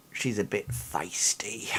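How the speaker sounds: soft whispered aside to the party. Acted. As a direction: lean in and whisper it. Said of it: "he just turns to you all and says".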